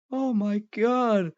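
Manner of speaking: slow, high mock horror; put-on drawn-out high dismay; imitated shock. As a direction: draw it out high like a shocked friend. Friend finds him collapsed, acted shock.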